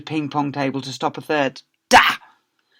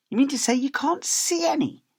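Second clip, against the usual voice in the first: mock innocent; 14 semitones higher than usual. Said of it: "she asked mock innocently".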